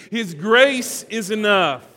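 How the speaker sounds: His grace is enough, loud and slow, grace hit hard; slow, loud, fervent declaration; with conviction. Shown as a demonstration. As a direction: proclaim it like you mean it. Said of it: "now say it like you mean it".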